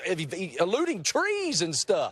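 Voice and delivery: high, fast, panicky protest; freaking out about ejecting, hammed up; mock panic. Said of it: "I'm like".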